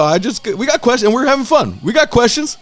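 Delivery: high-pitched placating protest; retold protesting plea. Retelling his plea to an upset friend.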